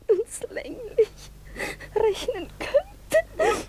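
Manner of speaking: tearful thanks. Acted. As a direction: voice breaking into tears, rising high. Princess thanks her rescuer, overwhelmed.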